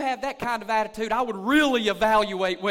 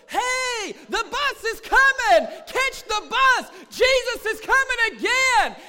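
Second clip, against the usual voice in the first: much higher, loud urgent calling out; hollering; 11 semitones higher, 7 dB louder, slower than usual. Retold or imitated. Pastor acting out calling to the lost, a loud high holler. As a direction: holler it like warning a crowd the bus is here. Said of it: "I'm gonna be hollering".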